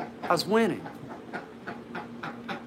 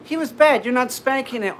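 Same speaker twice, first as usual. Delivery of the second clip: scolding mom; 11 semitones higher, 7 dB louder than usual. Retold or imitated. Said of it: "my mom comes down and is going".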